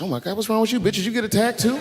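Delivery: high-pitched, startled questioning; high, urgent retold question; mock alarm. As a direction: blurt it out in exaggerated concern. Startled question to sobbing wife.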